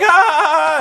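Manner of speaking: high, loud retching "ah"; mock disgusted groan. Mocking the crowd's disgust at the woman. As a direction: recoil in disgust. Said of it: "everyone's like".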